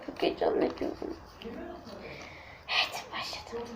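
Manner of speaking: hushed start; whisper. Real, not acted. Girl whispering to viewers as the game begins. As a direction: whisper so no one in the house hears.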